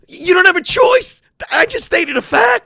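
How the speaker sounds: high, loud, emphatic outburst; yelling. Real, not acted. Comic insisting he just stated a fact, yelling. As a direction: raise the voice high and hammer it out. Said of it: "I'm not yelling".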